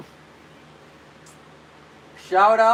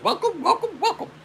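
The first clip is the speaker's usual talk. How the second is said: laughing falsetto mimicry of a woman streamer; high-pitched imitation; 14 semitones higher than usual. Copying how she welcomes viewers, very high imitation. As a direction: squeak the greeting way up high. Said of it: "get as high as she does".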